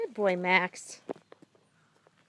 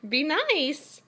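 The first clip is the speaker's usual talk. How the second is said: normal talking voice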